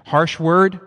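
punched out high and loud; harsh retort. Acted. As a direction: hit it hard, like firing back. Acting out raging back at child.

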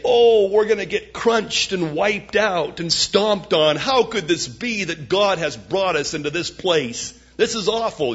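whiny fear. Acted. Mocking fearful others.

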